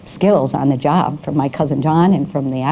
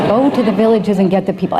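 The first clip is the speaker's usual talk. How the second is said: put-on Persian accent; 5 semitones higher than usual. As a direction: repeat it amused in a mock Persian accent, a bit higher. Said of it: "in their very thick accent".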